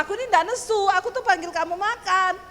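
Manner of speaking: high, loud self-defence; angry-sounding protest. Retold or imitated. Wife denying anger to husband, loud high protest. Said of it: "Itu nadamu tuh nada wong nesu".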